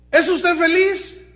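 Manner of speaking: high, loud, slow question; pointed question. Retold or imitated. Preacher voicing a reporter questioning Slim, loud and slow. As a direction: ask it loud and drawn out.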